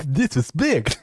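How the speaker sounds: babbling put-on voice; Oracle impression. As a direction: gibberish laughing impression. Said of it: "That's my Oracle impression".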